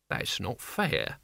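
whine. A narrator's reading. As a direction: whine it like a cornered servant. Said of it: "Now it was a whine".

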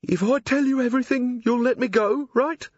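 a dim crook trying to sound sharp; Carey bargaining with Vimes, wheedling. A narrator's reading. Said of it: "wheedling tone the blunt-witted use".

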